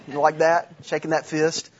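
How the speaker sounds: normal talking voice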